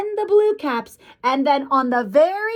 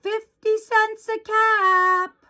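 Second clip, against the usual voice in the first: high, drawn-out street cry; vendor's call; 6 semitones higher, much slower than usual. A narrator's reading. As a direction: sing out wares like a peddler.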